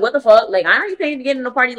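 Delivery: outraged disbelief. Retold or imitated. At the party door, refusing to pay the new cover charge, loud fast outrage. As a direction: blurt it out like you can't believe the nerve.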